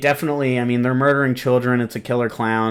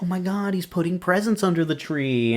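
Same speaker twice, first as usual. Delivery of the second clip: put-on awed little girl; 7 semitones higher, 4 dB quieter than usual. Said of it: "you see the kid's eyes just like".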